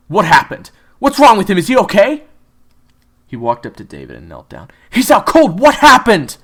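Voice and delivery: loud, high, urgent questioning; alarmed teacher. Acted. Teacher finding David knocked out, loud and alarmed. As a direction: demand answers in alarm.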